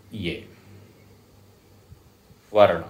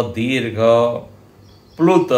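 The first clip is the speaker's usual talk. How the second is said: ordinary talk, nothing marked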